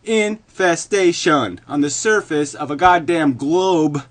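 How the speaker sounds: very loud, high, emphatic tirade; furious rant. Real, not acted. Condemning humanity as an infestation, furious rant. Climax of rant against the universe.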